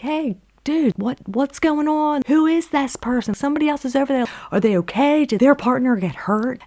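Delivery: high-pitched retold call to a partner. Yelling out to the partner about a stranger, alarmed call. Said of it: "yell out to your partner".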